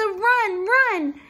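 high, very loud warning shout; frantic storybook alarm; urgent cry. A narrator's reading. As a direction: yell it in panic to get away. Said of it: "cried Melissa".

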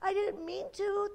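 small, high plaintive voice; remorseful storybook girl; crying girl. A narrator's reading. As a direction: plead as an upset child, high. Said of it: "the girl cried".

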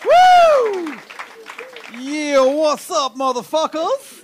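loud, high, stretched-out hype shout; hyped-up MC opener. Pretend. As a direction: blast it like a brash party host. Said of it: "I don't talk like that".

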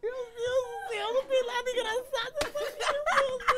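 very high wailing, laughing through tears; hammed-up squeaky sobbing; mock crying. Stoned wife crying to husband about laughing, high mock sobbing. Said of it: "ela começou a chorar".

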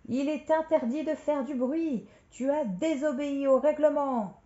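loud, high-pitched reprimand; outraged scolding. Acted. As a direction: lay down the rules in indignation. Outraged employee scolds roaring lion.

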